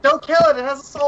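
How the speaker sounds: high, loud pleading cry; screaming at a spider. Real, not acted. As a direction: yell to save the spider.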